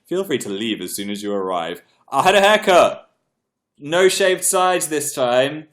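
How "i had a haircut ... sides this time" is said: bright announce. Real, not acted. Announcing new haircut.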